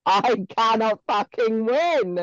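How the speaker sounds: slow exasperated groan of defeat. Retold or imitated. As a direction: drag it out, utterly fed up. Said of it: "And I'm like".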